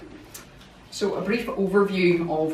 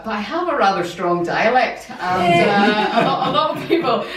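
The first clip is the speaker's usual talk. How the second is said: laughing; 4 semitones higher, 7 dB louder than usual. Real, not acted. Joking about her dialect, laughing.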